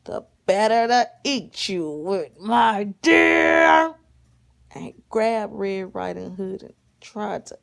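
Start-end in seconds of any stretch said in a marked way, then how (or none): 0.0-4.0 loud menacing wolf, building to a shout; storybook wolf roar; big bad wolf. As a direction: drop the disguise and pounce on the last word. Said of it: "And the wolf says".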